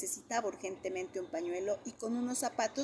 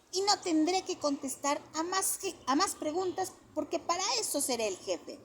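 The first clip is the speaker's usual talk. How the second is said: put-on a lightly higher child voice bragging; boasting little boy; 9 semitones higher than usual. A narrator's reading. Johnny boasts he'll be the boss, light child voice. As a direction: brag like a small boss.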